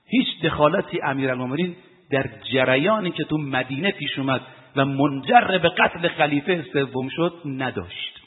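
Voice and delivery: ordinary delivery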